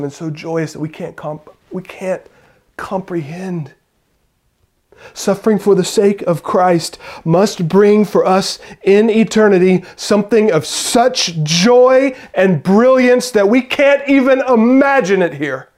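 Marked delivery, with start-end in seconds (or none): 5.1-15.7 loud, high, ringing preacher's voice; impassioned sermon climax; full-force preaching. Acted. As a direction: preach it out to the whole room.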